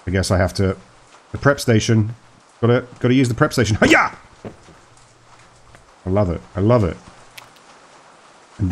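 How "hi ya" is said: very high, sudden chopping yelp; mock karate cry. Player chops lettuce at the prep station, mock karate cry. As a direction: let out a playful martial-arts cry.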